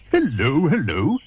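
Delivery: loud, high friendly greeting; cheerful hippo. Acted. Humpy Rumpy greeting the crocodile, loud and cheerful. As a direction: boom out a jolly hello.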